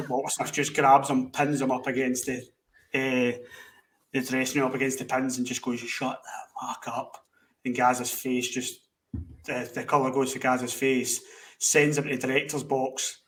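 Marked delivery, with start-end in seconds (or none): 5.8-7.2 slow, hushed and menacing; unvoiced slow threat, no shouting needed; quiet menace. Walter Smith pins Gazza to wall.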